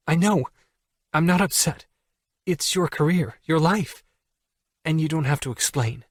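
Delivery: Allie insisting. Acted. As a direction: protest a little too much.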